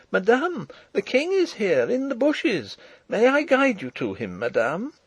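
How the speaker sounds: put-on high, eager girl's cry. A narrator's reading. Farm girl calling the princess to the king, crying out. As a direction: call it out high and urgently. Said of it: "curtsying and crying".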